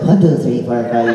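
put-on Irish counting; Irish brogue. Greeting an Irish actor friend whenever they meet, mock Irish brogue. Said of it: "I always say that to Adam".

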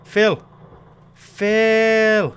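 high loud shout, then a long-drawn one; desperate yelling. Real, not acted. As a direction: yell it twice, stretching the second. Yelling at bottle to fill.

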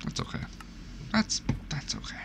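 soft whispered reassurance; whispering to himself after a scare. Real, not acted. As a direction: whisper it to yourself.

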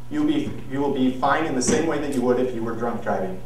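ordinary delivery

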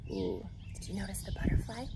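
quick, hushed aside; excited whisper. Shown as a demonstration. Pointing out a picture to children, quick whisper. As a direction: lean in and whisper it fast. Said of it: "aside to listeners, whispered".

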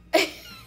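sudden high voiceless laugh; high breathy laugh. Real, not acted. Laughing at the border guard's flirting, high breathy burst.

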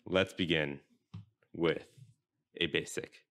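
slow intro broken by long pauses; deliberately slow, halting show opener; very slow stalling. Real, not acted. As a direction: stretch it out, pausing between phrases to buy time. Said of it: "I'm talking very slowly".

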